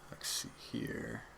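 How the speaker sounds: barely voiced, slow whisper under the breath; muttering to himself. Real, not acted. Host checking his place mid-review, muttering to himself. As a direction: mumble very low and slowly, almost inaudibly. Searching notes, talking to himself.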